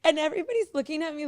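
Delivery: talking through laughter; laughing. Real, not acted. Mother still laughing while explaining the selling point.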